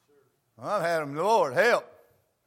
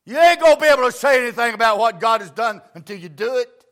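put-on loud, high, shouted sermon to the young people; preaching at full force; 8 semitones higher, 13 dB louder than usual. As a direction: drive the point home to the young people.

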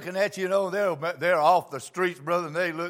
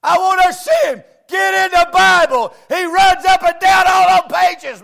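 high, loud revival shouting; full-force preaching; 13 semitones higher, 16 dB louder, slower than usual. Acted. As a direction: preach it to the rafters.

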